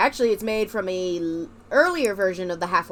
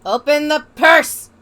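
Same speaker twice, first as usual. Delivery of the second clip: shout; 5 semitones higher, 10 dB louder, slower than usual. Real, not acted. Demanding the game open purse.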